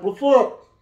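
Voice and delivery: high, rising reply; playful lifted-pitch greeting; imitated greeting. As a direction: toss back an exaggerated hello. His acted answer to happy greeting.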